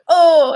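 loud, high yelp of pain, acted out; pained cry. Retelling a wrench falling on his foot, pained "oh". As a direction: imitate a sudden cry from a dropped wrench. Acts out character's foot being hit.

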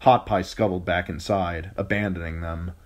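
ordinary delivery, nothing marked

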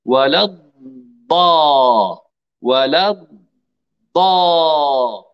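ولا الضا very slow with a burst on the ض; explosive daad. Shown as a demonstration. Teacher demonstrating how the daad bursts out. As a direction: let الضا explode after a long press. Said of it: "تنفجر الضاد".

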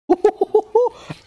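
very high string of quick hoots; high hooting laugh. Real, not acted. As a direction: hoot excitedly, way up high. Hooting at the military police.

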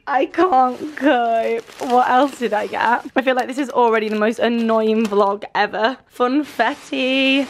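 usual speaking voice

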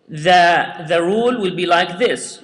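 usual speaking voice

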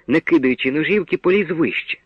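usual speaking voice

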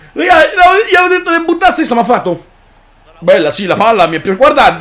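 ordinary talk, nothing marked

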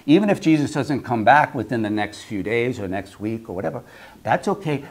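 normal talking voice

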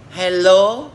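loud put-on English greeting; mock English hello. Mocking English learners, a loud mock hello. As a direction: ham up a foreign hello. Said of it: "các bạn thử sủa với câu tiếng Anh nào".

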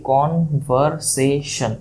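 কনভারসেশন slowly, in four separate beats; syllable-by-syllable demonstration. Shown as a demonstration. Teacher giving a polysyllable example, কনভারসেশন split into four syllables. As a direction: break it up as কন-ভার-সে-শন. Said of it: "আমি বলি".

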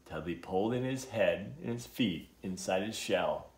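ordinary delivery, nothing marked

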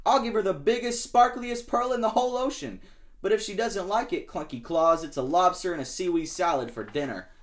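high, loud boasting threat; boastful shark. Acted. Shark boasting to Lobster, high and loud. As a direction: brag big then threaten.

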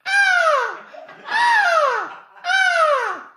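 high falsetto moans, hammed-up; mock moaning.

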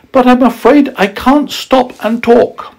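put-on very high, polite young girl; sweet child's voice; little girl voice. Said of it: "she said politely".

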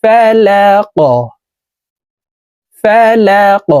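ফালাক ending with a bouncing, pushed-up ক, high; qalqala on the qaf. Shown as a demonstration. Teacher drilling the echoing qalqala stop. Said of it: "কফ কলকলার হরফ ... ধাক্কা দিয়ে".

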